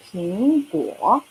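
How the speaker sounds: 苹果 drawn out slowly, 苹 rising second tone, 果 dipping third tone; teacher modelling the tones of 苹果; slow tone demo. Shown as a demonstration. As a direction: say 苹果 slowly so both tones are heard. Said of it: "So 苹, that the second tone".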